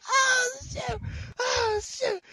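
high squealing laughter; giddy relief. Real, not acted. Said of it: "I can't stop laughing".

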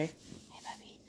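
breathy whisper, barely there; whispered aside. Real, not acted. Mother whispering to her little boy mid-podcast, unvoiced whisper. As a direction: hush it to the little one playing nearby.

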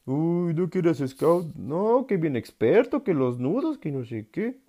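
voz burlona más aguda y lenta; burla de scout. Retold or imitated. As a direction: imitando a quien se burla del scout. Burla imitada de otros al scout. (imitando burla: "¿no que eres scout?")

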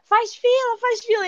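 high-pitched mock calling out; imitating shouting parents. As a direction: call out like parents organising a queue. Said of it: "os pais começaram a gritar".

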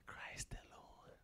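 unvoiced whisper; whispered answer. Real, not acted. Congregation answer whispered. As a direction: breathe the answer.

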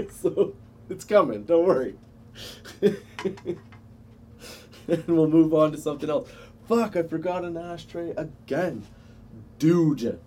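giggly and high; stoned laughter; stoner giggle. Real, not acted. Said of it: "I'm really high right now".

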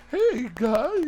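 put-on very high, loud squeaky boy voice; squeaky cracking teen voice. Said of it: "that's how my voice sounded for a while".

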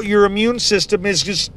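usual speaking voice

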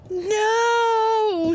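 very high, loud mock wail; hammed-up wail. Wife sees the gamebook and wails in mock horror. She dreads the game book.

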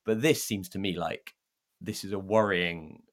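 ordinary talk

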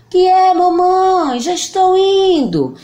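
voz de menino, alta e lenta; boy voice. Acted. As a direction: menino respondendo de longe. João responde. (voz de menino)

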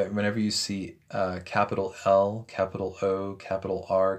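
ordinary talk

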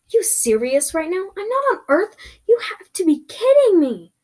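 high, loud disbelief; incredulous. Acted. Learning they are not on Earth, incredulous outburst. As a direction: exclaim in stunned protest.

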